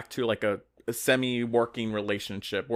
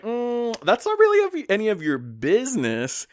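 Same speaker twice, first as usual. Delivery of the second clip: much higher, louder sassy brush-off; sassy retort; 11 semitones higher, 5 dB louder than usual. Retold or imitated. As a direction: toss it off with a high cheeky lilt. Mike sassing Harvey.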